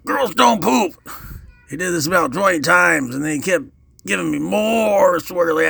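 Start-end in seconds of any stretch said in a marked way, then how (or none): 0.0-0.9 high-pitched bully's yell; retold yell. Bully between toilet flushes, yelling at his victim. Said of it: "yank my head out and yell".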